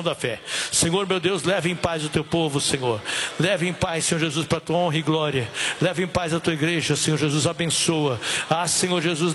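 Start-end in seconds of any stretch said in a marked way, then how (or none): none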